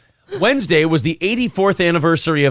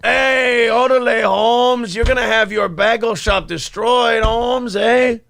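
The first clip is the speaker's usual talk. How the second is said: put-on hardcore Mexican accent; 6 semitones higher, slower than usual. Host imitating the caller's hardcore Mexican accent. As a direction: shout it like a cartoon Mexican tough guy. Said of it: "hardcore Mexican accent".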